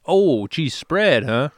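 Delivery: drawn-out, exaggerated Wisconsin accent; mock Wisconsin accent. Podcaster imitating a TSA agent about cheese spread, mock Wisconsin accent. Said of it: "I don't know why I'm doing that accent".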